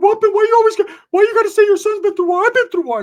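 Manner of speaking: very high, loud jealous whiner; Ricky. Retold or imitated. Friend jealous of the praised son, high loud mock protest. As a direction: squawk it in outraged comic falsetto. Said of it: "Ricky's like".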